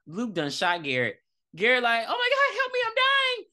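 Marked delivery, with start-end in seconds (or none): 2.1-3.5 very high-pitched dramatic plea; mock dying panic. Podcaster acting out a shot boy begging for help, mock dying panic. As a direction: wail it in a squeaky mock panic.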